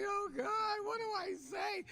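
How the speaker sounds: high, loud, flustered inner voice; starstruck panic. Retold or imitated. Coach reliving the moment her idol sat down, high flustered panic. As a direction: panic at meeting your hero.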